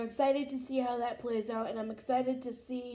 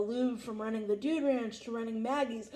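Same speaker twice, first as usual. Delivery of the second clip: ordinary delivery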